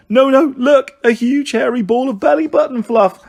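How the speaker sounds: high, loud, excited yell; jeering playground bellow; bellowing child. Acted. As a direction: outdo the others with a shout. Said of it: "bellowed a third".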